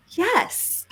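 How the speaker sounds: a breathy, star-struck little yes; breathless yes. Retold or imitated. Answering Branson about her daughter, a starstruck little yes. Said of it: "I take a deep breath".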